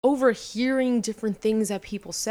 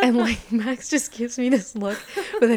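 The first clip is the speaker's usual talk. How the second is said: laughing through the words, high; 4 semitones higher than usual. Real, not acted. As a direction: giggle while starting to talk. Laughing at the story.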